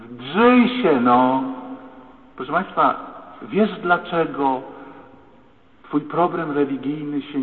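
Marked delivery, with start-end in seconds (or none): 0.1-1.4 raised, exasperated urging, drawn out; urging yell. Retold or imitated. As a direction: push someone to yell, louder yourself. Psychologist urging girl to shout. (ponaglający, podniesiony głos)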